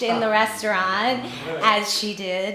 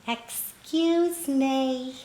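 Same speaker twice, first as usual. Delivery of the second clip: put-on slow, small old woman's voice; little voice; 3 semitones higher, much slower than usual. Little old woman tries to get the crying waitress's attention, slow and small. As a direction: call gently and slowly for attention. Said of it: "I heard this little voice".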